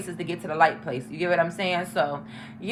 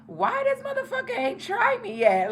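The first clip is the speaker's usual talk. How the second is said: much higher, disbelieving squeal; voicing her puzzled thought about the friend, high and incredulous; baffled high squeak; 13 semitones higher than usual. Quoting herself about the guy who never tried, squeaky disbelief.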